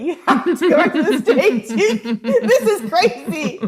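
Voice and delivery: high, loud, helpless laughter through the words; laughing hard. Real, not acted. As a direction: crack up while scolding yourself. Laughing at her own self-talk.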